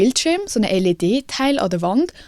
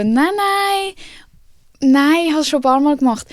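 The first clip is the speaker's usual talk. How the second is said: much higher, an overly bright "no, no"; high insistent denial; 6 semitones higher than usual. Retold or imitated. Speaker reassuring doubtful staff, high and insistent. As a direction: brush off their doubt in a high, over-polite voice. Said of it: "Und dann bist du so".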